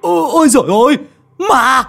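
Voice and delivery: high, loud panicked screaming for the master; terrified shriek. Acted. Lâm Sẹo screaming to his master about the ghost, terrified shriek. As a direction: shriek for help in pure terror. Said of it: "Lão rú lên một tiếng".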